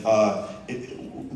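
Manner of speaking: ordinary delivery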